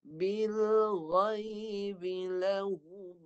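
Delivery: "بِالْغَيْبِ" with a voiced, heavy ghain from the tongue root; teacher modelling the verse; recitation demo. Shown as a demonstration. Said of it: "Cuba baca".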